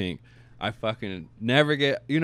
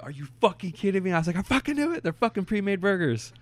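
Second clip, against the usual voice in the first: high, incredulous retold outburst; outraged disbelief; 11 semitones higher than usual. Yelling at the manager in disbelief about pre-made burgers. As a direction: pitch way up in mock outrage.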